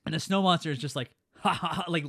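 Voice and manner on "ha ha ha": loud mock villain laugh; imitated gloating laugh. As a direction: laugh as if lording it over everyone. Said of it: "laughing and lording over all of them".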